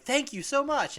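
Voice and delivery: high-pitched rescued damsel; imitated grateful girl. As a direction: gush thanks in a put-on high voice. Rescued girl's thanks acted out.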